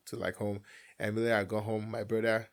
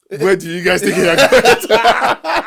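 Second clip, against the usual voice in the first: high, slow laughing mock dad voice; hammed-up stern father stopping them, laughing; 11 semitones higher, 20 dB louder, much slower than usual. Dad blocking the car, in a laughing mock stern voice. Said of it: "My dad came from nowhere".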